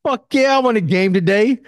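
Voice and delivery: hyped for game day; excited. Retold or imitated. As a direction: friends calling him to play, excited yes. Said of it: "when my homies call".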